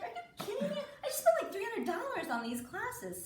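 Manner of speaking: high-pitched mock outrage; exasperated parent. Mother protesting wasted lesson money, high exasperation.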